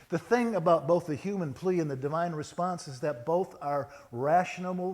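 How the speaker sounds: usual speaking voice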